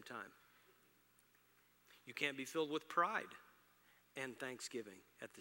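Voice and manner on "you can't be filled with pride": usual speaking voice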